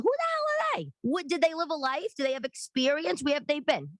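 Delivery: indignant rant. Real, not acted. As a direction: scoff at so-called experts. Ranting about Instagram experts.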